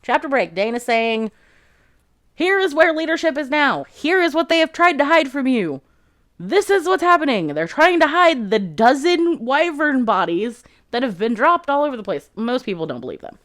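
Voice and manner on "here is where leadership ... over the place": high, projected announcement; proclaiming to a crowd. Retold or imitated. Dane reveals the cover-up to the formation, proclaiming. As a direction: address the whole formation, pitched up.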